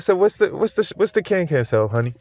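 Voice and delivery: high-pitched mock innocent ask; mock sweet question. Husband teasing wife about can sale, high mock sweetness. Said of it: "I'm like".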